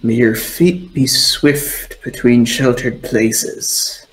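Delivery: slow, measured blessing in character; withery old mystic. Acted. Bilgy blessing Jane on deck at dawn, slow and solemn. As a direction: intone it slowly like an old ritualist. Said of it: "He just looks at you and goes".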